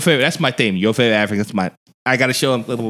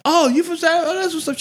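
much higher, softening retold reply; mock polite surprise; 12 semitones higher than usual. Visitor answering the South African lady, mock polite surprise. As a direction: act out a high-pitched polite "oh".

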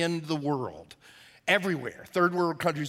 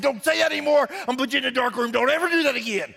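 raised, higher-pitched telling-off; acted scolding; 5 semitones higher, faster than usual. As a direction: scold the bird like a fed-up owner. Angry owner scolds the bird.

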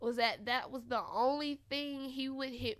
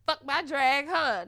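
louder, sassier drag-queen delivery; drag queen quote; 4 semitones higher, 10 dB louder than usual. Retold or imitated. Quoting a Drag Race queen for fans who get it, loud and sassy. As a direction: serve it with drag-queen shade. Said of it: "for the people that do get it".

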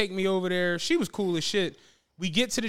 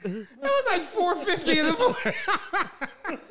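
laughing; 7 semitones higher than usual. Real, not acted. Laughing at his own pizza story.

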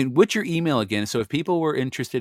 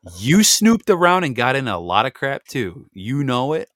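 louder, slow, needling jab; teasing accusation; 4 semitones higher, 7 dB louder than usual. Retold or imitated. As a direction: rub it in, playfully scolding. Husband teases wife in quarrel.

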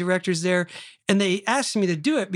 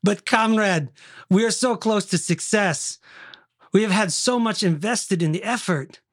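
put-on Russian accent; 2 semitones higher than usual. Pleading with a comrade to continue, Russian accent. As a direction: protest like a hammy Russian comrade. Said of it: "Did you do a good Russian accent".

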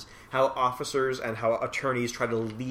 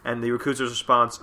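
usual speaking voice

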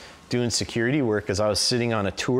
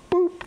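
popping noise; 3 dB louder than usual. Retold or imitated. Arm popping out of joint.

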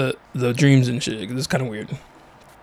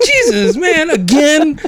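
laughing; 10 semitones higher, slower than usual. Real, not acted. Laughing at the recurring dream.

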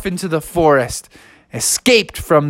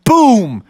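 boom sound effect; 6 semitones higher, 4 dB louder than usual. A narrator's reading. Sound effect in bedtime story.

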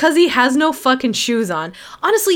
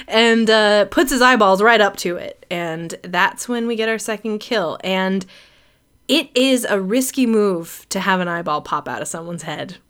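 ordinary delivery

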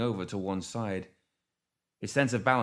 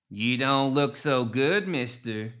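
higher, slow backwoods drawl; loud, drawn-out old gremlin matriarch; 4 semitones higher, 5 dB louder, slower than usual. A narrator's reading. Narrator voices Ma Tuckett, swamp gremlin.

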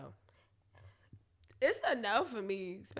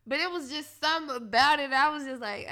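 ordinary delivery

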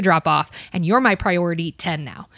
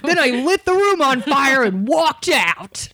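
slow, higher-pitched bragging, hammed up; mock-heroic boast; 5 semitones higher, slower than usual. Waitress exaggerating her story for the podcast, mock-heroic. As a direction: draw it out like a tall tale.

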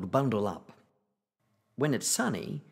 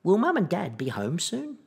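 put-on higher, rising child's question; child asking; 7 semitones higher, 3 dB louder than usual. A narrator's reading. Child asks granddad about parents, higher rising question. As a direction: lift it into an eager question. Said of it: "And now ask".

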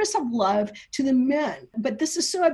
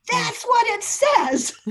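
laughing; 10 semitones higher, slower than usual. Real, not acted. Laughing at the reversed reading.

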